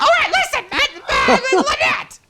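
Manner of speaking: high, loud flustered protest; exasperated scolding. Acted. Host reining in crude guest Lynette, exasperated scolding. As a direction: cry out to shut the guest up.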